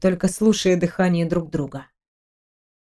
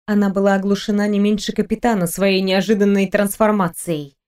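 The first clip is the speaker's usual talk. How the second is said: plain reading voice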